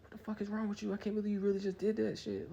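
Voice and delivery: high-pitched mock girlfriend voice; upset girlfriend. Girlfriend to him after the jump, mock upset scolding. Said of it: "my girlfriend at the time".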